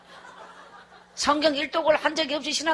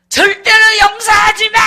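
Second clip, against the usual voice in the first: very loud, high anguished shout; imitated weeping outburst; 10 semitones higher, 14 dB louder than usual. Husband crying alone in self-reproach, anguished shout. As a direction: roar it out in self-loathing. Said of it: "하면서 막 울더라고".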